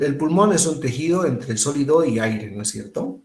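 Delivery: ordinary talk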